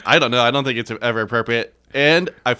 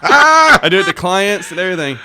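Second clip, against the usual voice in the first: normal talking voice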